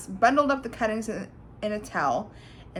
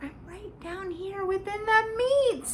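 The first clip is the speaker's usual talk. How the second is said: crying out; 12 semitones higher than usual. A narrator's reading. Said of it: "screamed at the top of his lungs".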